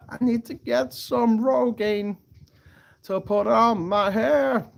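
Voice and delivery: high, slow, drawn-out put-on voice; mock performance voice. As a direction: stretch it out in a hammed-up high voice. Performs a mock line about Rogaine.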